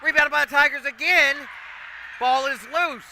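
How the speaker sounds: excited shout. Real, not acted. Announcer calling the loose-ball scramble, excited shout. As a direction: yell every rebound. Scramble for rebounds called live.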